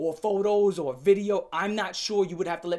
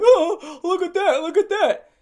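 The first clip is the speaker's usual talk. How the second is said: loud, high mock jeering; mocking crowd; 11 semitones higher, 10 dB louder, slower than usual. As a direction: hoot like a crowd pointing and laughing. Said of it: "pointing and laughing".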